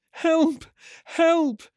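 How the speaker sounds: very high, panicked squeal; hatchling's cry. Acted. Hatchling cries out, flying blindly through the cavern, panicked. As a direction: cry out in a tiny, piercing pitch. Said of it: "he cried".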